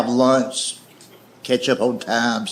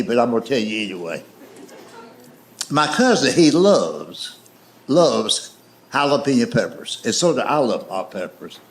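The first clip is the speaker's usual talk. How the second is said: ordinary delivery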